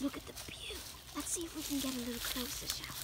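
unvoiced stalking whisper. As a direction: whisper so it won't hear. Stalking, told to be quiet.

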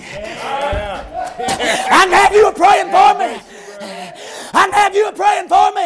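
drawn-out, shouted preaching at full force; fiery preaching. Acted. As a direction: lift it up over the congregation.

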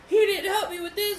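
high-pitched, loud mock complaint; exaggerated high venting voice; upset girlfriend imitation. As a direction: mimic a girlfriend venting in a squeaky voice. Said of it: "it just got me infuriated".

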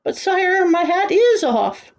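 high-pitched, drawn-out protest; pleading protest. A narrator's reading. Boy protests to the angry king.

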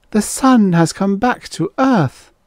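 high-pitched proclamation of wonder; awed. A narrator's reading. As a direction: marvel aloud, voice pitched high. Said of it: "they said in awed voices".

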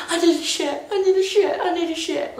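high-pitched, repeated mock wail; retold urgent plea. Mother re-enacts telling partner in labour, high urgent plea. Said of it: "like that".

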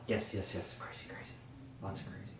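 soft hissed goblin whisper. Acted.

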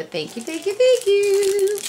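rising into high, loud thanks; bubbling-over real gratitude; excited gratitude. Real, not acted. Thrilled thanks for a gift.

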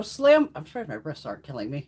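ordinary talk

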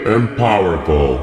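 slow, drawn-out imperious god; great and powerful Neptune. Acted. Neptune challenges the visitor, slow and imperious. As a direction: stretch the words out like a mighty ruler. Booming Neptune confronts Dorothy on stage.

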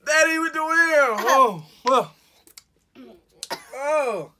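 high loud pained cries; pained shout. Real, not acted. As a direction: cry out from the sourness. Sour candy shock, shouting.

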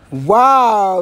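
a bright, high, loud wow; surprised wow. Retold or imitated. Vlogger meeting her boyfriend, a loud surprised wow. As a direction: gasp it out in delight.

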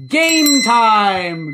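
loud, drawn-out announcer call; big game announcement. Real, not acted. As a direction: belt it out, stretching both words.

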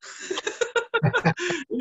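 high, bright burst of laughter; laughing at the client's reply. Real, not acted. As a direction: laugh out at the absurdity. Laughs after retold reply.

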